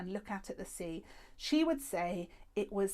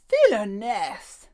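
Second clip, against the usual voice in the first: louder, slow, drawn-out cry; mock outrage; 15 dB louder, much slower than usual. A narrator's reading. The old lady losing at cards, loud mock outrage. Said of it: "Madame Badobadar cried".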